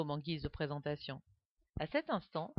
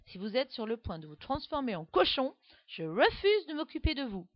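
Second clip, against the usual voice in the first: put-on higher stern little girl telling off; stern warning; 6 semitones higher than usual. A narrator's reading. Alice warning the baby, stern girlish scolding. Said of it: "le prévient sévèrement Alice".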